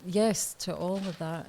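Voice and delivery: usual speaking voice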